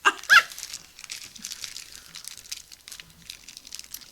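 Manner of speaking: breathy high giggle; high laugh. Real, not acted. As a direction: giggle with delight. Laugh over candy.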